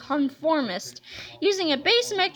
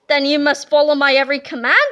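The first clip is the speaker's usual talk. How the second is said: commanding villain; 2 semitones lower, 7 dB louder, faster than usual. A narrator's reading. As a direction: order the minions about. Villain gives orders.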